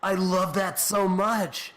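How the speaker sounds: slow, high gushing delight. Real, not acted. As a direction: savor every word with joy. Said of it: "delighted reaction, slow and high".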